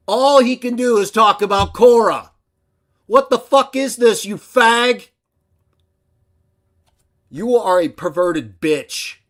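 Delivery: high, loud, enraged shout; furious yell. Real, not acted. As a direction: scream it at the screen. Yelling at rival.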